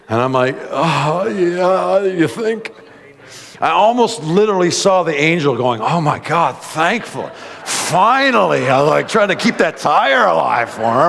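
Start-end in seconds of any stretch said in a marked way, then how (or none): 0.7-2.7 high mock sarcasm, louder; preacher retelling a sarcastic reply to the attendant, high and loud. 5.8-8.7 high, slow relieved sigh of an angel; preacher voicing a relieved angel, high and slow.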